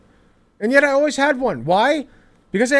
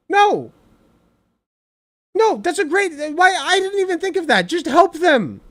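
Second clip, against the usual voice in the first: mock astonished; 7 semitones higher than usual. Real, not acted.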